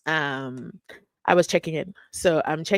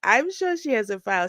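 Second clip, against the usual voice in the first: usual speaking voice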